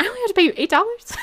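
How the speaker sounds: high, fast astonished question; can't believe the good news; shocked guest asking the island staff about the price; incredulous disbelief. Retold or imitated. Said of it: "I was like".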